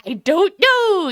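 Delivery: slow, drawn-out unsure "I don't know"; mock hesitant answer, slow; hesitant mock reply. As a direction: stall it, unsure. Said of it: "and you're like".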